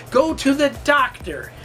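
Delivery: normal talking voice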